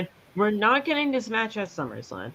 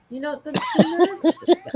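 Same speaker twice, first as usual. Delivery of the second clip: higher, laughing through the words; laughing disbelief; 8 semitones higher, 4 dB louder than usual. Real, not acted. Fan mocking a wrestler on screen, laughing exasperation. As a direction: laugh at the nerve of it.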